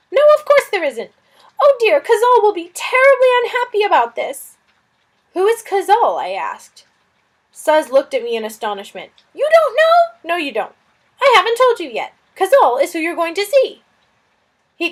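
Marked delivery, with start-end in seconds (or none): 0.1-4.6 high, loud, fretful lizard voice; a flustered little lizard fretting over a guest; fussy lizard. Acted. Lizard Suz fussing about the stranger, high and loud. 9.3-13.8 high, loud, fretful lizard voice; a flustered little lizard fretting over a guest; fussy lizard. Acted. Lizard Suz fussing about the stranger, high and loud.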